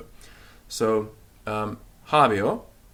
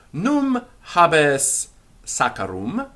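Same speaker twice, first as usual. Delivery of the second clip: slow model question; 6 semitones higher, 5 dB louder, much slower than usual. Shown as a demonstration. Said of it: "teacher models question".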